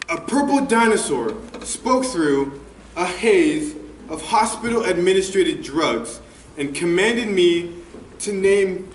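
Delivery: usual speaking voice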